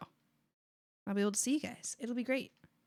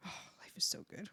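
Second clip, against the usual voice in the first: soft breathy whisper; whispered bliss; 4 semitones lower, 10 dB quieter, faster than usual. Real, not acted. Streamer savouring her good luck, whispered bliss.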